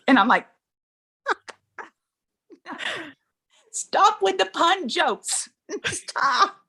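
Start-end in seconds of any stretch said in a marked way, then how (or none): 1.3-6.6 high, slow, laughing groan at a pun; laughing protest. Retold or imitated. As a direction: whine through laughter.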